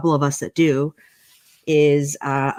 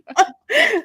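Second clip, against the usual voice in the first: high laugh; 5 dB louder than usual. Real, not acted. Laugh after joke.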